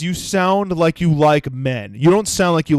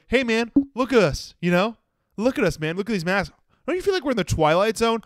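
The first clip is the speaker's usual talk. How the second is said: higher, softer mimicked passenger; lightly mock a chattering seatmate; retold chatty passenger; 7 semitones higher, 3 dB quieter than usual. Plane passenger rambling about masks, mock chatty. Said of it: "This guy was just going five miles a minute".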